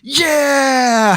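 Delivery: very high, drawn-out holler; triumphant yell. Retold or imitated. Storyteller reliving his walk back with the tree, triumphant yell. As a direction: let out a long, loud whooping cry. Acting out himself walking up.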